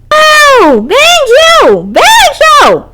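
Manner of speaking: high, loud yell; yelling a name. Acted. Girls searching the neighborhood, yelling loud. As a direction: shout for the missing cat. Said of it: "continued yelling his name".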